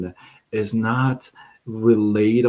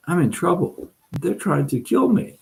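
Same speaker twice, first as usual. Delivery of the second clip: higher-pitched, mock-frightened plea; a lightly acted mouse in fear for its life; alarmed pleading; 8 semitones higher than usual. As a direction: plead for help as if hunted.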